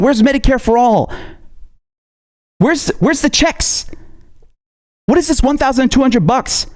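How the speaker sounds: furious rant at a politician; angry rant. Real, not acted. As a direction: let the fury rise.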